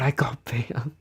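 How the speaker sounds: stunned whisper; whispering in disbelief at the ban. Retold or imitated. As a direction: breathe it out in a whisper. Shocked at being banned.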